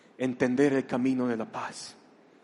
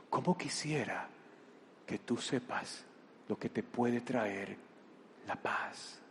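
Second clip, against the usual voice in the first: slow, softer plea that fades to a whisper; near whisper; 12 dB quieter than usual. Acted. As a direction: breathe it out slowly, tenderly. Jesús repite, casi susurrando. (súplica lenta, casi susurrada)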